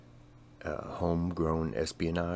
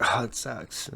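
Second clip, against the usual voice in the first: mock sympathetic groan; 6 semitones higher, 6 dB louder, slower than usual. Voicing what everyone thinks.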